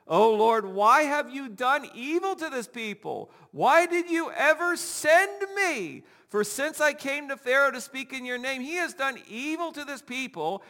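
high, loud desperate cry to God; anguished Moses. Acted. Moses crying out to the Lord in anguish.

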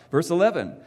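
usual speaking voice

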